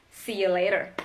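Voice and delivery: 'See you later' as 'see ya later'; reduced, linked 'See you later'; shortened you. Shown as a demonstration. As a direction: turn 'you' into 'ya' in 'See you later'. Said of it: "kata you itu dipendekin jadi yah".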